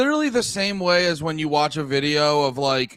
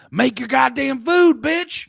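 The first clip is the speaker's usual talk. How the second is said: raised, high, drawn-out mock yell; mock bullying order; 8 semitones higher, 5 dB louder, slower than usual. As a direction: order her around in hammed-up Southern fury. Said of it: "behind the camera with a whip".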